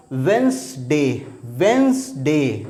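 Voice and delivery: वेंसडे drawn out slowly, d silent; slow model pronunciation. Shown as a demonstration. Teacher repeats वेंसडे slowly for students to copy. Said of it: "तो इसको बोला जाएगा".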